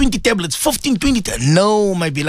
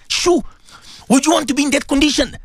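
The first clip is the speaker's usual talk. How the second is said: ordinary delivery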